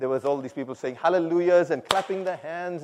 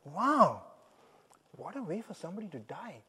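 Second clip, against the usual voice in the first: soft, awed murmur; dropping to a hushed wonder; moved; 6 dB quieter than usual. Retold or imitated. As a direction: let it sink in quietly. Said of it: "which really moved my heart".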